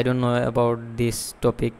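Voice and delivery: slow, drawn-out bad answer; unhurried wrong answer; slow wrong example. Shown as a demonstration. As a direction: model the phrase to avoid, slowly. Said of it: "কখনোই বলা যাবে না যে".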